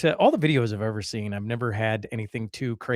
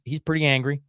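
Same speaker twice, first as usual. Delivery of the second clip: usual speaking voice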